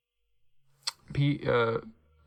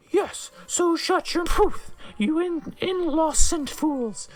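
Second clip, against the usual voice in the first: much higher, slow hammed-up old professor yelling; shrill mock yell; 13 semitones higher, 3 dB louder, slower than usual. A narrator's reading. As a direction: screech it like a furious old teacher, slow. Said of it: "yelled Professor McGonagall".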